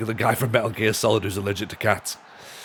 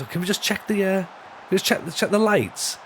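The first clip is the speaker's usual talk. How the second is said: much higher impersonated performer voice; Julian Clary; 8 semitones higher than usual. Acted. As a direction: ask politely in a high, lilting put-on voice. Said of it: "Julian Clary's going like".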